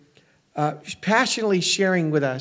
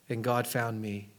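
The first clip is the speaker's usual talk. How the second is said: softer and slower, almost hushed; hushed, reverent; 6 semitones lower, 10 dB quieter than usual. Retold or imitated. Preacher quoting a prisoner's letter, soft and slow. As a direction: let the words sink in quietly, in awe.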